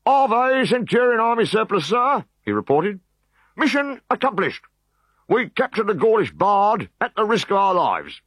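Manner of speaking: high, loud proud report. Acted. Decurion reporting to Centurion Army Surplus, high and proud. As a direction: report the triumph smartly.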